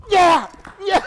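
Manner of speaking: loud, high hollered yeah, yeah; mock holler. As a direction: imitate a singer hollering "Yeah, yeah" over his song.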